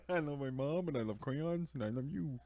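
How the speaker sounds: put-on high, little-kid sing-song; childlike voice. Kid reciting a sweet note to mom. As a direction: read it like a small child proudly reciting. Child voice quoting kid's card.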